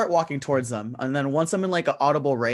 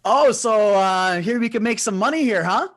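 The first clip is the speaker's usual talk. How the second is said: raised, higher in-character voice; loud, pitched-up interested interjection; calling out; 6 semitones higher, 4 dB louder than usual. Acted. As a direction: butt loudly into the gamblers' talk. Player calls out to gamblers in character.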